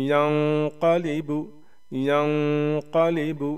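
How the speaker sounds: यंक़लिबु with a long nasal hum before क़; nasal ghunna. Shown as a demonstration. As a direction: hold the nasal of यंक़लिबु, then release into क़. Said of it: "same उसी हिसाब से यहाँ पर पढ़ेंगे".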